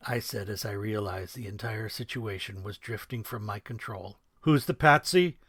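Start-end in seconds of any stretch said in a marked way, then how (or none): 4.4-5.4 Who's the Patsy? echoed louder and higher, rising on Patsy, then a loud snap; loud mocking retort, hired gun brushing him off. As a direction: throw the question back at him with loud scorn. Major mocks the question back.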